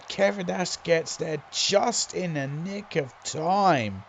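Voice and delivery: ordinary delivery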